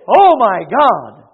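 loud, drawn-out mock exclamation; imitated outburst of shock, slow and loud. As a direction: cry it out slowly as if shocked. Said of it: "when you see something that shocks you".

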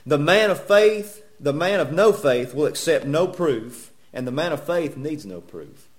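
slow, loud opening that settles as it goes; deliberate pulpit rereading, stretching each key word; emphatic preaching. Real, not acted. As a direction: drive the saying home slowly. Rereads saying slowly, forcefully to congregation.

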